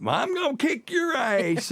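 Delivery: high, drawn-out mimicked comeback; mock threat in a high-pitched, slow twang; Tacy. Teasing co-host impersonating Tacy firing back at a man, high mocking drawl.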